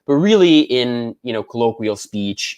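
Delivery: ordinary talk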